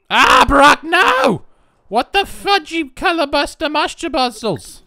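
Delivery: high, loud squeal of panic; high-pitched panicked yelling; panicked shriek. Real, not acted. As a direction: shriek at the character who's ruining it. Game character ruining the sale.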